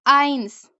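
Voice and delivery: आइंस with an English eye sound; आइंस said slowly with ai; clear ai sound. Shown as a demonstration. Teacher models आइंस slowly for the class. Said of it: "किसकी आवाज निकाली है".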